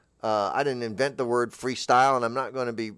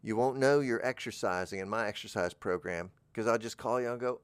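ordinary delivery

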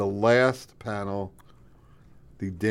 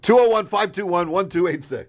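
high, loud number plug; excited shout; 9 semitones higher, 5 dB louder than usual. Acted. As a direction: belt out the number like a DJ.

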